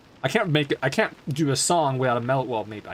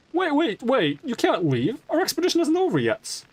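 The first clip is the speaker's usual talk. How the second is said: much higher, urgent character voice; 14 semitones higher, 3 dB louder than usual. Acted. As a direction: stop them from leaving. Another character objects.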